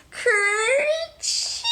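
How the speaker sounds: high, loud acted sneeze. Peter sneezing while hiding in the can, high acted sneeze. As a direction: fake a big sneeze. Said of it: "Peter sneezed".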